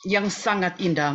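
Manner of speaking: fighting tears, moved; choked with emotion. Real, not acted. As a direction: voice catching, holding back tears. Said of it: "Mohon maaf, saya terharu". (suara tercekat menahan haru)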